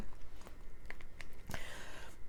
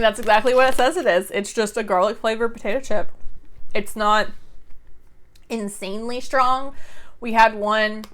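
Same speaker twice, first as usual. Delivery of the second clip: ordinary talk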